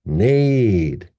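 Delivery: "Nade" long, arching up and down; stressed syllable. Shown as a demonstration. Teacher repeating stressed syllable, "Nade" long and strong. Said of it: "High, strong, long".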